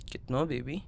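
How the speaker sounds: soft intimate flirting; tender, soft flirting. Acted. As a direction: murmur it close and affectionate.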